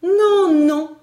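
very high, loud cry of alarm; anguished. A narrator's reading. Miss Barbara rejecting Elsie's explanation, anguished. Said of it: "dit miss Barbara avec angoisse".

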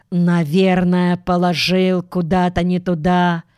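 plain reading voice

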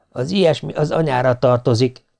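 ordinary delivery

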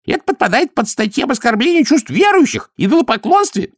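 very high, outraged priest voice; indignant accusation. A narrator's reading. High priest accuses Vimes of offending believers, indignantly. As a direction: denounce him in a high, indignant pitch.

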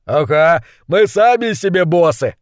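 smug thug. Acted. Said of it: "подтвердил крысолов номер два".

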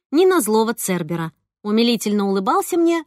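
ordinary delivery

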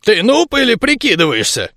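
surprised. A narrator's reading. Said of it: "удивился маг".

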